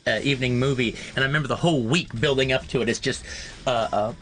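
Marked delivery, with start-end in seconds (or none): none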